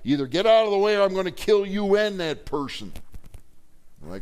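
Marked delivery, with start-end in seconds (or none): 0.1-2.9 high, loud, threatening shout; gunman's threat. Retold or imitated. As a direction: yell the last warning high and loud. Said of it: "Guy says".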